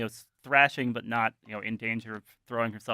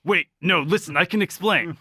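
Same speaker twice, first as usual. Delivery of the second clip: higher, louder hurried protest; urgent pleading; 7 semitones higher, 6 dB louder than usual. Acted. Carl at the door begging to explain, urgent. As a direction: plead to be heard, voice raised.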